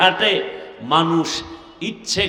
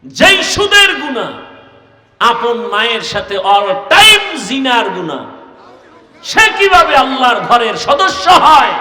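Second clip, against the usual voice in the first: loud, high indignant challenge; thundering sermon rebuke; full-force preaching; 5 semitones higher, 8 dB louder than usual. Acted. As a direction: cry it out to the congregation.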